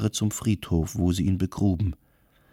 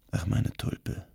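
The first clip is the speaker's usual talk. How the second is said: faint, breathy whisper of a dying man; barely voiced, fading words to his wife; weak whisper; 7 dB quieter, slower than usual. A narrator's reading. Dying husband to his wife, faint whisper. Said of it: "flüsterte der Hoxha".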